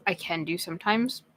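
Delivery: plain reading voice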